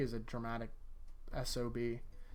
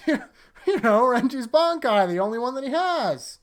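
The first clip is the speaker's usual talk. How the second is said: high, loud, slow mock-emphatic; mock insistence; 9 semitones higher, 10 dB louder than usual. Real, not acted. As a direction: overplay the point sarcastically. Mock-emphatic about one Bankai.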